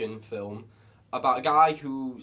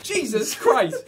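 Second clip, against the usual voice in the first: louder, higher, drawn-out mock shock; startled exclamation; 6 semitones higher, 6 dB louder, slower than usual. Viewer jumping at a film's doorbell, startled outcry. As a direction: exclaim as if jolted by a sudden noise.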